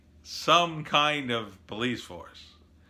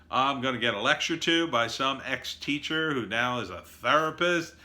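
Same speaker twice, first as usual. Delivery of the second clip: usual speaking voice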